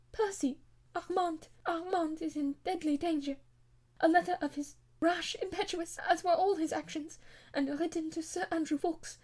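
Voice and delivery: a troubled noblewoman blurting out her heart; impulsive lady. A narrator's reading. Marguerite reveals brother's danger.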